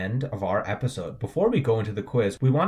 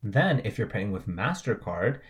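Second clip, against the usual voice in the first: ordinary talk, nothing marked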